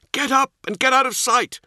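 high, loud shouted command; shouted order. Acted. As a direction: yell the order to the squad.

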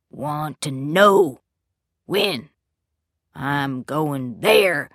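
slow, heavy, menacing; slow menacing growl. A narrator's reading. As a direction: lean in and draw it out. Possessed father repeats menace.

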